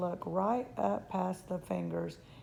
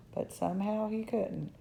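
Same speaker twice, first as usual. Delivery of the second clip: ordinary delivery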